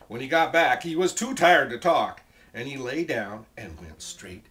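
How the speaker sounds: loud, animated storybook reading; story narration. A narrator's reading. Teacher reads the dragon's flight to children, loud and animated. Story read at full volume.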